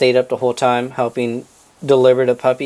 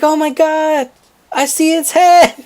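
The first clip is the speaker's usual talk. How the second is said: high, drawn-out squeal of alarm; shocked exclamation; 13 semitones higher, 4 dB louder, slower than usual. Retold or imitated. Child spotting the puppy's head, high shocked squeal. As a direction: imitate a stunned cry, rising louder at the end.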